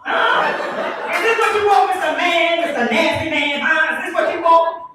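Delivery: high, loud, laughing taunt; over-the-top pretend flasher; mock seductive taunt. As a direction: push it in his face, hammed-up. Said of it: "And I go".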